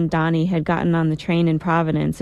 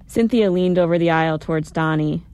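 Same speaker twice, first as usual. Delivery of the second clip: ordinary delivery, nothing marked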